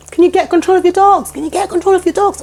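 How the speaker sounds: high shouted call; retold scream. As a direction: yell across the green at the dog owners. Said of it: "I started screaming into the distance".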